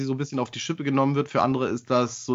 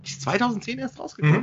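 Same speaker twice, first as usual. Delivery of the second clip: surprised; 10 semitones higher, faster than usual. Real, not acted. Surprised at release year.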